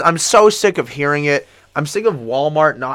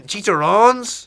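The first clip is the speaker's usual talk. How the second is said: higher, slow drawn-out Spanish word; mock accent; 6 semitones higher, much slower than usual. Joking about tacos in a slow put-on accent. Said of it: "accent bit".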